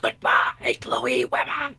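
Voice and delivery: monster voice. Retold or imitated. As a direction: breathe it like a creepy monster. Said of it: "in the exact monster voice".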